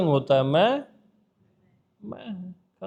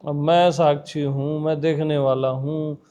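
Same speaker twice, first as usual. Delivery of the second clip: low, measured mock-mystic chant-like listing; mock spiritual recitation; 6 semitones lower than usual. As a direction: recite lofty titles in a low, even voice. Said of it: "ये सब मिर्च मसाला लगाना है".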